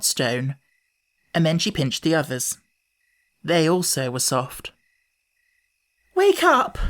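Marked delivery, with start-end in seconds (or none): 6.1-6.8 high, louder joyful call; joyful near tears. A narrator's reading. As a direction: wake them, overjoyed. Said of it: "almost in tears for joy".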